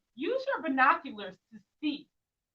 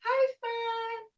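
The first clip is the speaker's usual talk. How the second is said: bright high call to the sun, much higher; high sing-song greeting; 10 semitones higher, 4 dB louder, much slower than usual. Shown as a demonstration. Teacher leading kids in a high sun greeting. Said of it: "why don't you say".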